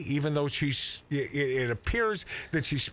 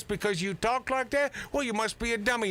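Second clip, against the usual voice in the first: higher, mock condescending voice; mocking the left; 8 semitones higher than usual. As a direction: sneer it like a smug critic. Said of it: "the left loves to label people that talk like this".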